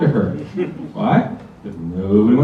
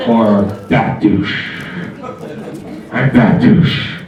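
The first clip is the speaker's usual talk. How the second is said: low, slow gravelly hero voice; superhero voice; 7 semitones lower, much slower than usual. Pretend. Comic crowning himself, low slow superhero growl.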